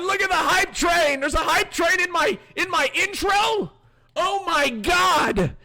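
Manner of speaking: high, loud excited yelling; excited shouting. Real, not acted. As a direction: shriek with real surprise. Hype train appears during announcement.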